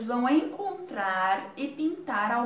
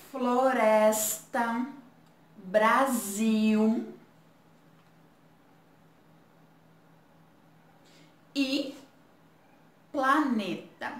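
reading aloud; much slower than usual. Shown as a demonstration. Teacher leading the class reading the word list aloud. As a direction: read the words like a chant for children. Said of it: "Agora vamos ler essas palavrinhas?".

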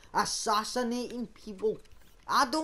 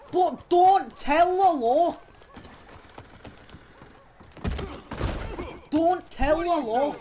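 loud, slow, raised plea; calling after someone; 5 semitones higher, 5 dB louder, slower than usual. Pretend. Outlaw stopping a witness reporting him, calling out. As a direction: call it out, stretching the words.

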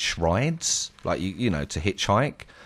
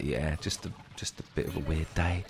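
much softer, embarrassed brush-off; downplay it quietly, a little sheepish; sheepish reply; 5 semitones lower, 11 dB quieter than usual. Retold or imitated. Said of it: "And I was like".

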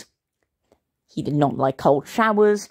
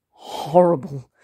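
shuddering disgust; slower than usual. A narrator's reading. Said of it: "Dad shuddered".

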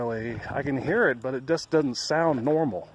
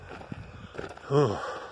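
weary sigh; 5 dB quieter than usual. Real, not acted. Sigh after singing.

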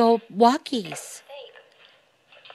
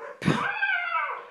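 high surprised gasp; sharp gasp; 6 dB quieter than usual. Real, not acted. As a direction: catch your breath in alarm. Sudden gasp at dog.

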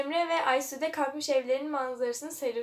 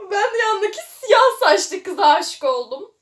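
slow, loud, higher, cracking up; laughing through lines; 7 semitones higher, 12 dB louder than usual. Acted. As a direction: read the confession while dying of laughter. Reading love line while laughing. (gülmekten kırılarak okuma)

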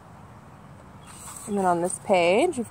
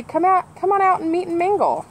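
higher, quicker coaxing call; crow voice; 8 semitones higher than usual. Acted. The crows calling kids to play, higher and quick. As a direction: coax them out like a friendly crow. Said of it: "and then the crows say".

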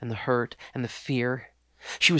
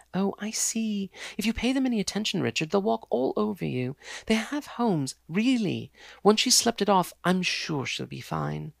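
higher, brisk and condescending; dismissive fiancée; 7 semitones higher than usual. Acted. Fiancée dismisses the homeless girl, brisk and condescending. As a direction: brush the problem aside.

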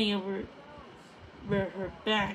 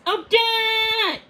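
high, loud shrieked name; 12 semitones higher, 8 dB louder than usual. A narrator's reading. Puffin shrieking a warning to Odette. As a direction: shriek her name in alarm. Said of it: "Puffin shrieked".

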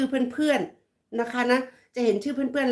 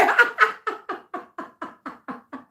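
a high, burst-out giggle; real amused laugh; 8 semitones higher than usual. Real, not acted.

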